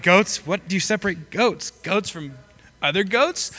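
baffled questions, pitch lifting on "goats"; mock puzzlement. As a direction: question it as if it makes no sense. Acts out puzzlement at misquote.

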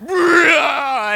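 high, loud roar sliding downward; hammed-up Hulk transformation yell; Hulk rage scream. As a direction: tear into a mock monster scream. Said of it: "turning green and ripping his sh".

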